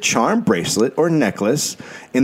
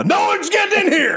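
protective father shout. Retold or imitated. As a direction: bellow like a guarding dad. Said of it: "keep standing up and going".

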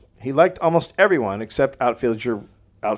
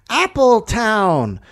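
high, slow mock slogan read; slogan announcer voice; 9 semitones higher, slower than usual. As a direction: sell the town slowly like an ad.

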